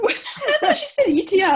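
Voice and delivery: high, loud laughing. Real, not acted. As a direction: laugh through the words. Laughs at her mishearing.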